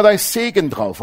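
mock popular belief. Preacher voicing what people always think, mock-confident. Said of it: "Die Leute denken immer".

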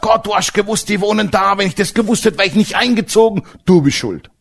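retold sing-song angry prayer blaming God; mock angry complaint. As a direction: whine the blame at God, half mocking. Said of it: "dann bin ich zornig geworden, hab gesagt".

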